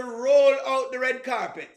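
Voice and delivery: emphatic, lengthened "roll"; stress on roll. Shown as a demonstration. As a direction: lean hard on the word roll. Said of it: "Emphasis on the word roll".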